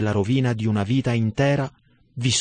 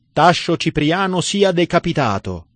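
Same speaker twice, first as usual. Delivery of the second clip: raised, higher proclamation of the death sentence; pronouncing sentence; 6 semitones higher, 3 dB louder than usual. A narrator's reading. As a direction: hand down the verdict from the bench. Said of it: "sentenziò il proconsole".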